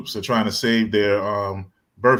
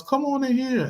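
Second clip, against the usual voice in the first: put-on higher, inviting old lady voice; nice old lady; 14 semitones higher than usual. Old lady inviting the girl inside, sweet higher voice. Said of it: "And she goes".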